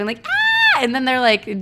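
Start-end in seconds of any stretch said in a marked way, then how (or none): 0.3-0.8 very high, short happy shriek; overjoyed squeal. Retold or imitated. Showing friends how happy she was, joyful shriek. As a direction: shriek with glee. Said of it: "imagine me so fucking happy".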